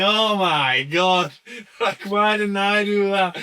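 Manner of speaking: high, loud and drawn out; amazed retold outburst. Young comic watching the older act get laughs, loud amazement. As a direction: marvel in exasperated awe, stretching the words. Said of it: "And I was like".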